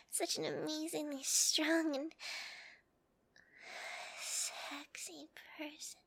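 slow, breathy whisper; whispered aside. Acted. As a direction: say it to yourself under your breath. Admiring aside under her breath.

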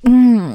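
loud moan. Acted. Moaning during the act.

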